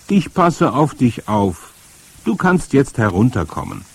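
loud, comforting; reassuring call. A narrator's reading. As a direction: coax the child down from below. Grandmother reassures boy from below.

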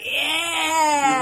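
loud, drawn-out whoop; hammed-up cheer for the camera; drunken cheer. Drunk woman posing with a fan, imitated excited cheer. Thumbs-up pose in fan photo.

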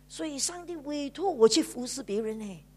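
ordinary delivery

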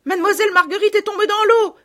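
very high, loud, fast cry of alarm; alarmed shouting. A narrator's reading. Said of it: "criaient les bonnes femmes".

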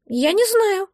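high little creature voice; admitting. A narrator's reading. Egg asked who is inside, squeaky admission. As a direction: confess it in a squeaky voice. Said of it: "призналось яйцо".

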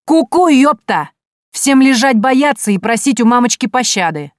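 loud, high bellow bursting into the room; bark. A narrator's reading. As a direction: yell it like a raid. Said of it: "ворвалась в зал и гаркнула". (громкий окрик с порога)

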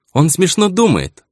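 high, slow schoolgirl voice; bright young girl talking; young ringing voice. Acted. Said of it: "Голос у неё оказался юный и звонкий". (юный звонкий голос гимназистки)

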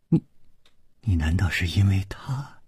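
stunned whisper. A narrator's reading. Bear Lady realising Gong Nanyan's motive, stunned whisper. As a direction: gasp it out as it dawns.

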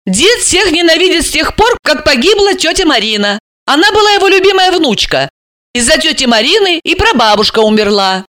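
high-pitched character, calmly correcting; Alisa. Acted. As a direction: play Alisa setting the facts straight.

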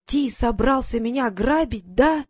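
grab him and growl the accusation; angry growl. Acted. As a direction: foreigner in broken Russian accusing his rescuer. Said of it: "сердито прорычал".

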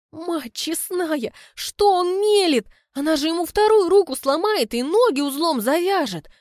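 horrified disbelief at his words; alarmed exclamation. A narrator's reading. Narrator's alarmed inner exclamation. (потрясённое восклицание)

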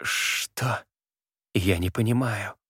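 breathless whisper. Acted. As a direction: whisper it, confused and weak. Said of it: "прошептала воровка".

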